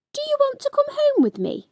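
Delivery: put-on high, fast, hopeful girl's question; eager little girl. A narrator's reading. Shannon asks the toy dog to come home, high and eager. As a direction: ask it quickly like a thrilled seven-year-old. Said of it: "asked Shannon".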